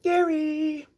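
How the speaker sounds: calling a pet in a high squeal; high sing-out call; high-pitched call. Real, not acted. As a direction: squeal the name, drawing out the end. Calling out to guinea pig.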